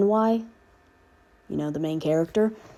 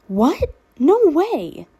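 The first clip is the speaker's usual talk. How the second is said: put-on higher, louder filtered girl exclaiming; excited exclaim; 9 semitones higher, 5 dB louder, much slower than usual. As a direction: blurt it out, excited and a tad scared. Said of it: "the girl exclaimed".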